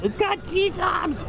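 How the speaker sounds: high, slow, thrilled squeal; excited high squeal. Real, not acted. Thrilled at spotting a car.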